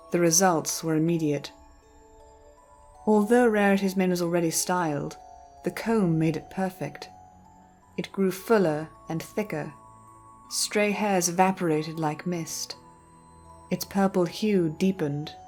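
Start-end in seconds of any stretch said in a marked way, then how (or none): none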